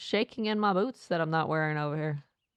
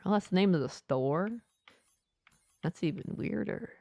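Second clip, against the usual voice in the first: usual speaking voice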